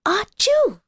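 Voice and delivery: one big sneeze; boy sneezes. A narrator's reading. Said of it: "sneezed the little boy".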